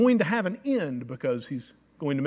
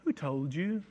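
"Who told you" soft and light, rising at the end; gentle soft asking; 2 semitones lower, 7 dB quieter, slower than usual. Shown as a demonstration. Contrast take of God asking Adam kindly, soft and rising. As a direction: ask "Who told you" quietly, no edge. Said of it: "a lot closer to how that conversation went than".

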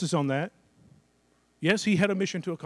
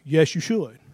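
normal talking voice

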